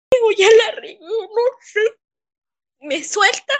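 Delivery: crying through the words as she remembers; real crying. Real, not acted. Crying while retelling assault.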